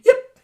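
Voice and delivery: sudden high loud yip at the end; high yelp. Shown as a demonstration. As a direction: yelp it sharply upward. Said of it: "imagine if a singer did that".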